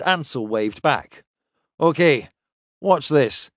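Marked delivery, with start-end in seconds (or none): none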